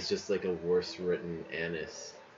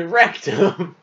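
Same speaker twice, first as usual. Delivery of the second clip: laughing through wrecked 'em; 9 semitones higher, 12 dB louder than usual. Shown as a demonstration. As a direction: giggle out the pun. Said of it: "say rectum".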